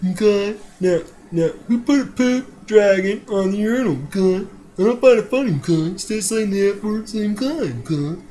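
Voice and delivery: nasal-free, sing-song counselor scolding, okay?; Mr. Mackey impression. Counselor lecturing kids about the urinal, high scolding voice. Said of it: "his voice is easy, 'cause it's just like".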